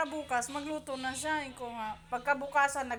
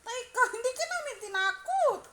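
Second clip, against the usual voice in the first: higher, louder mock boss voice; imitating sir; 9 semitones higher, 6 dB louder than usual. Coworker quoting the boss's denial in a higher voice. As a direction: ape the boss defending himself. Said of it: "Ganito kasi sinabi ni Sir".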